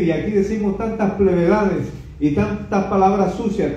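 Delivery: ordinary delivery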